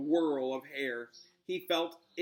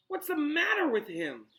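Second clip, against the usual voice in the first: high, louder alarmed question; 13 semitones higher, 5 dB louder than usual. Acted. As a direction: ask in a panic. Ron alarmed by the rat.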